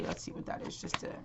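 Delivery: under-the-breath whisper to herself; whispered aside. Real, not acted. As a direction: murmur it privately while looking at the cards. Muttering to herself over cards.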